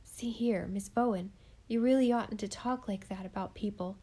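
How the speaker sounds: ordinary delivery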